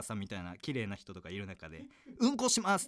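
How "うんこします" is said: high, loud re-enacted announcement; big loud voice. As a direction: blurt it out at high volume. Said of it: "でっかい". (でっかい声で再現)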